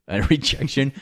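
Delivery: laughing through the word; breaking into a laugh mid-word. Real, not acted. Joking about rejection.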